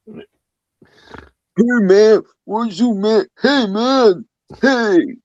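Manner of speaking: a mock Philly guy greeting you; Philly accent. Shown as a demonstration. Showing off his Philly accent to Ollie, hammed-up Philly accent. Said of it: "My Philly accent's pretty good".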